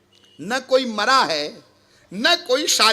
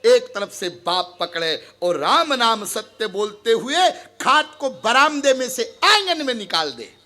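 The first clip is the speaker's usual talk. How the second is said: ordinary talk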